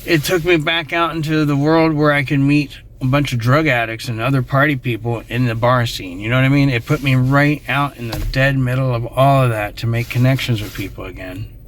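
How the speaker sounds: ordinary talk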